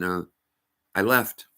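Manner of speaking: ordinary talk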